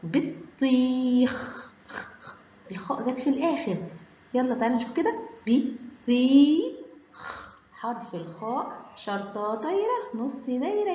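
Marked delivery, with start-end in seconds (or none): 0.0-1.7 بطيخ stretched slowly, long ii and held final kh; drawn-out word. Shown as a demonstration. Teacher sounds out the word. 5.5-6.8 بطيخ loud and very slow, syllables held apart; drawn-out word. Shown as a demonstration. Teacher sounds out the word.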